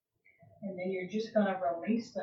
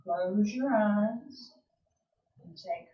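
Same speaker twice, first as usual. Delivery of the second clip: guided calm cue; 4 dB louder than usual. Acted. Eases class out of stretch.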